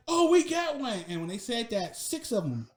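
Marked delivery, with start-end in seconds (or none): 0.1-1.0 high, strained mock holler; imitating drill sergeants. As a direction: crow it like sergeants spotting a victim. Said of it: "they looking at me like".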